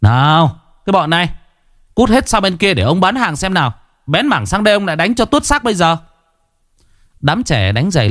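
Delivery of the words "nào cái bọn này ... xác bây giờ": high-pitched scolding vendor; cross stallholder threatening a thrashing; shooing shop owner. A narrator's reading. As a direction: chase the kids off with a high, rising scold. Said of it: "người chủ hàng phải ra xua đuổi".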